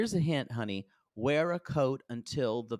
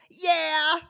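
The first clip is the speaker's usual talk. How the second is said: high, loud squeal of yes; mock-thrilled bride accepting; excited yes; 13 semitones higher, 6 dB louder than usual. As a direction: squeal it like an overjoyed fiancée. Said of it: "And she goes".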